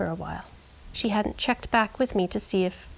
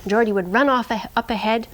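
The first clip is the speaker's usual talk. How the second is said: normal talking voice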